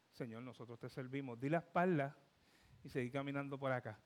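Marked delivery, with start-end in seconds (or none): 0.1-1.4 soft, low complaint in prayer; annoyed. Retold or imitated. Husband repeats his silent complaint to God, quietly annoyed. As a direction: grumble it under your breath to God, mildly annoyed.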